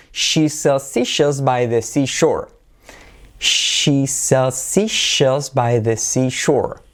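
"seashore" and every sh and s stretched out; very slow, sound-by-sound tongue twister; slow pronunciation model. Shown as a demonstration. Teacher modelling the extended version, slow and careful. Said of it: "versión extendida".

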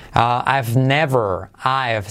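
ordinary delivery

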